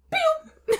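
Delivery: high-pitched "pew"; playful little zap noise; mock sound effect; imitated closing sound. Imitating chastity belt closing.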